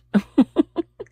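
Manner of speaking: short high embarrassed laugh. Real, not acted. Laughing at herself after crying over the waiter. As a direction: laugh off the tears, a little sheepish.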